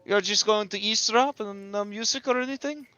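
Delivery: high, loud, quick teasing; Danica. Acted. Danica teasing the musician about eavesdropping, high smiling voice. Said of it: "Danica kinda smiles at Bertavio and says".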